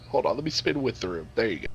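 ordinary delivery

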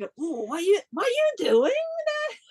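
high, curious postman calling out; imitating a postman who is surprised and peeks in. Postman spotting the artist at work, a high surprised call. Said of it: "He was like".

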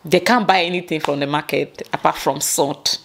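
ordinary talk

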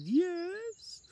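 put-on very high old lady voice. Old lady answers the man at her door, very high voice. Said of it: "my best old lady impression".

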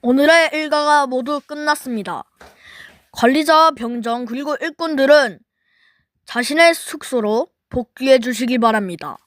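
loud formal loudspeaker announcement; PA announcer. Acted. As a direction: announce it over the speakers. PA announcement to workers.

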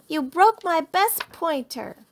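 slow, high, loud blame; shouted accusation. A narrator's reading. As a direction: spell out the offence angrily. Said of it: "shouted Lizzie".

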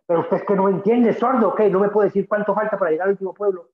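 loud, high, irritated demand; the traveler loses patience; exasperated. Retold or imitated. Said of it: "ya ofuscado".

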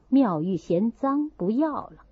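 plain reading voice

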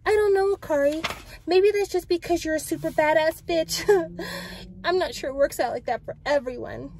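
high-pitched character voice with a little laugh; acted light, high-voiced doubt; doubting friend. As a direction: tease admiringly, pitched well up.

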